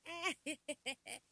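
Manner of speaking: high, loud imitated cackle; mock laugh. Mimicking friends carrying on, high mock laugh. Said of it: "Why y'all all like".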